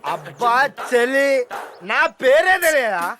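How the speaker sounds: put-on slow slurred drunk talk. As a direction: drag it out like a drunk. Drunk caller introduces himself.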